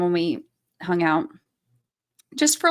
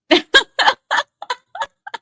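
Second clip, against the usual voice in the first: high, squealing helpless laugh; laughing fit; 3 dB louder than usual. Real, not acted. Streamer overcome by a funny comment, high helpless laughter. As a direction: burst out laughing once more, high-pitched.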